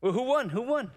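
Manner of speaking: high, playful asking; curious coworker. Retold or imitated. Coworker wandering up to ask who won, high. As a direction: tease them about the winner. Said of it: "my coworker came up".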